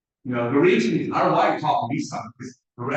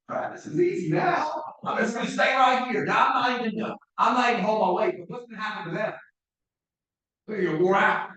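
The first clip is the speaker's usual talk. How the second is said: mocking voice; 7 semitones higher than usual. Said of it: "you know what I'll do".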